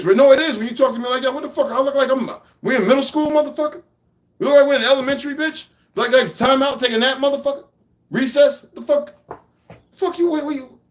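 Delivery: high-pitched, incredulous mocking rant; mocking a friend who talked down, pitch rising; indignant rant. Real, not acted. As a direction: scoff at him, voice climbing in disbelief.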